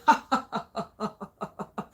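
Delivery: mock villain's "ha, ha, ha"; gloating laugh. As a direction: laugh as if relishing someone else's pain. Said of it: "I almost picture a narcissistic person".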